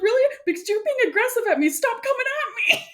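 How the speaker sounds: high and fast, indignant; mock defensive. Player scolding her aggressive friend, mock indignant. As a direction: push back like you're offended. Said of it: "I was like".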